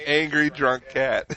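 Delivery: laughing shout. Real, not acted.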